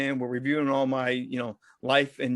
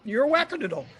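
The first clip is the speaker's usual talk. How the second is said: teasing nurse; 10 semitones higher, faster than usual. Retold or imitated. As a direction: top the last jab with wackadoodle. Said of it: "They're like".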